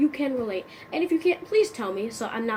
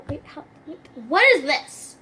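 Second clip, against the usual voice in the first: confused panic; 4 semitones higher, 5 dB louder than usual. Acted. Confused by homework.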